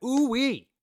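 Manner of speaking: high, loud "ooh-wee"; mock fired-up whoop. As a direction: whoop as if your blood is boiling. Acts out being fired up.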